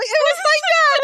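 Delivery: very high, squealed exclamation; amused astonishment. Retold or imitated. Retelling the possum popping up alive, squealed astonishment. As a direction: burst out high, on the edge of laughter. Acting out possum surprise, laughing.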